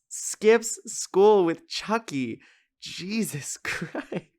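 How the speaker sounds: every word high and drawn out in "skips school with Chucky"; all-caps emphasis. Retold or imitated. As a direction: stretch "Jesus Christ" very slowly in high, laughing disbelief. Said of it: "I wrote in all caps".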